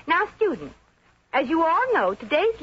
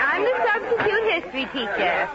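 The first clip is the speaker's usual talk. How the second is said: flustered substitute teacher; 5 semitones higher than usual. Acted. Substitute teacher addressing unruly class.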